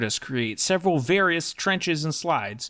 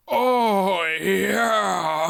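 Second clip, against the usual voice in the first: pleased groan. Acted. Swinub dips feet in water.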